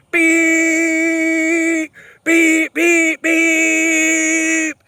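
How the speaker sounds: high, loud beeping like a horn; mock car horn beeps. Cyclist mimicking the tailgater's horn, loud high beeps. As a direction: honk it out like the angry driver's horn. Imitating driver laying on horn.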